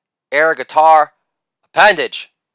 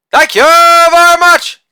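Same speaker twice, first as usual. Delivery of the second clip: loud "Holla", then a very high, loud "thank you very much"; hammed-up falsetto victory call; catchphrase shout; 12 semitones higher, 6 dB louder than usual. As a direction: belt out the catchphrase like a hype man.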